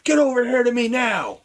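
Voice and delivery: high yelled order. Acted. Player yelling at guards to come over, high yell. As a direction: call them over hard. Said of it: "I'll yell at them now".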